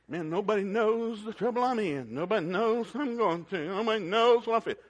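mock whining. Mocking complaining Christians.

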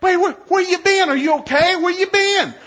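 high, loud comic alarm; frantic questioning. Retold or imitated. Dad to his late-finishing daughter, frantic. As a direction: yell the questions at her in disbelief.

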